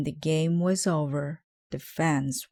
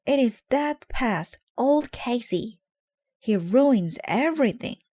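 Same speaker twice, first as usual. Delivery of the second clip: alarmed; 7 semitones higher, 4 dB louder than usual. A narrator's reading. Lou alarmed at Casey.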